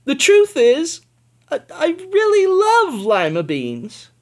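high, loud, rushed admission; blurted confession. Acted. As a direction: finally blurt out the truth.

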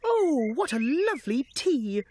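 delighted. Acted. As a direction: gasp at the lovely tea. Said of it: "they all said".

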